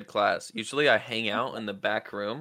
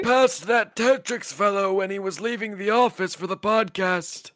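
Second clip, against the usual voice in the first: higher put-on voice, playing the visiting streamer; mock guest voice, a bit raised; Chris Ventura voice; 7 semitones higher than usual. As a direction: speak as Chris arriving at the podcast. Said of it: "Hi, Chris".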